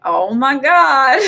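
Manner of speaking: a drawn-out, hammed-up groan of disbelief; exasperated oh my God. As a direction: let the disbelief stretch every word. Retold exasperated reaction.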